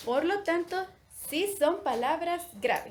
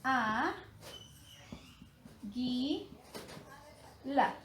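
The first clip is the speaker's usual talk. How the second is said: "A, gui, la" split into separate syllables with long pauses; 6 dB quieter, much slower than usual. Shown as a demonstration. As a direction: draw out each syllable of "A, gui, la", pausing well between them. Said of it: "Vamos a dividir la palabra águila en sílabas".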